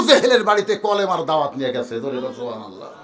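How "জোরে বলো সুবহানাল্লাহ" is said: ordinary talk, nothing marked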